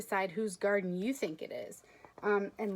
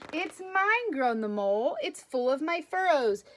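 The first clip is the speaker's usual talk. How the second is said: plain; 3 semitones higher, 3 dB louder than usual. Acted. Reads picture book aloud.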